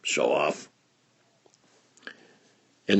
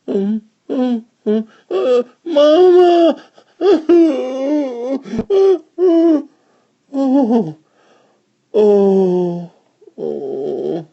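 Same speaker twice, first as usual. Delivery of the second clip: high, loud wailing for mama; lost child crying; 7 dB louder, much slower than usual. Acted. Lost little child cries for her mama, wailing. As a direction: sob out for your mother. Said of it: "Lost and found".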